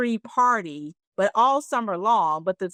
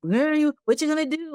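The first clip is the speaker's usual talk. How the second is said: naysayers nagging; 5 semitones higher than usual. Retold or imitated. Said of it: "people try to tell you".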